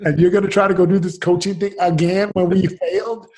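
imitating upset wife. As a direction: press him hard as the wife. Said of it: "my wife, she's crying".

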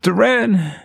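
high, drawn cry of a name in pain; high-pitched, pained calling. Acted. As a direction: call out the name as if hurting. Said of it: "calling your name in pain".

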